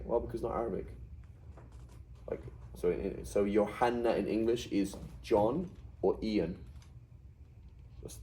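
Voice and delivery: ordinary delivery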